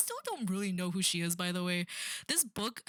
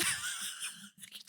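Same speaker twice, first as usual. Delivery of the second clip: breathy laugh; 6 dB quieter than usual. Real, not acted. Laughing at the book's premise.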